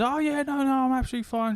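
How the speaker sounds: hammed-up high friend's voice; mock Gem voice. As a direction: play her airy fake-calm reassurance. Mimicking Gem's breezy reply.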